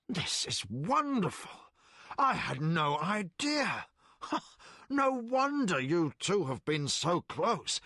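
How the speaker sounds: put-on an old man thrilled by discovery; delighted Colgan.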